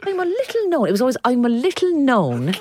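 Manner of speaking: high, lilting mock Irish host; imitated Irish quiz-show clue; Irish accent. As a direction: pitch it up with an Irish lilt. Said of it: "apologies for the Irish accents".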